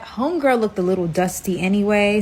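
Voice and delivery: usual speaking voice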